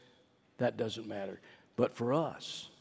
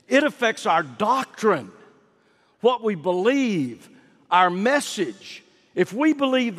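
higher, louder, slow and deliberate preaching; preaching at full force; 7 semitones higher, 7 dB louder, slower than usual. Acted. Preacher to congregation on doctrine, forceful preaching. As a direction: pound each point home from the pulpit.

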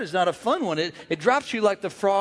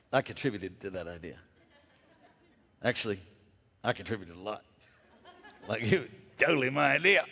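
much lower, softer, laughing mumble; sheepish claim; 10 semitones lower, 9 dB quieter than usual. Retold or imitated. Claiming credit in the meeting, a low embarrassed mumble. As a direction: slip it in sheepishly under your breath. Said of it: "And then I say".

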